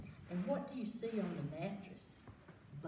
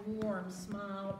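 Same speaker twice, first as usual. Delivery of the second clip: usual speaking voice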